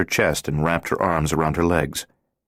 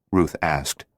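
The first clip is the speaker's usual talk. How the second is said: ordinary delivery, nothing marked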